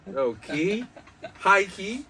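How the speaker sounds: rising to a high, loud cry; pumped-up shout of excitement; hyped exclamation. Retold or imitated. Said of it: "I'm all like".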